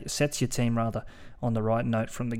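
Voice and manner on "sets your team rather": ordinary talk, nothing marked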